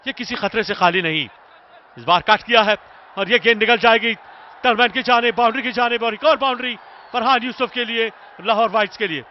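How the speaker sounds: loud, high boundary call; excited cricket commentary. Real, not acted. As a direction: shout it as the ball races away. Boundary called live. (जोशीली cricket commentary)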